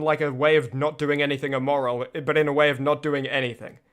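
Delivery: ordinary talk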